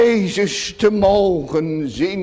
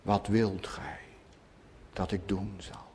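much lower and softer, gentle asking; hushed tender question; 11 semitones lower, 16 dB quieter than usual. Retold or imitated. Preacher voicing the Lord's question to Bartimaeus, hushed and gentle. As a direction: ask it as if bending close to a blind man. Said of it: "dat vraagt de Heere vanmorgen ook".

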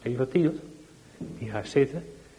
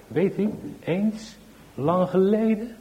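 put-on storytelling child; 7 semitones higher, slower than usual. Child starts telling a story.

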